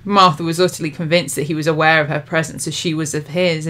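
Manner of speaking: plain reading voice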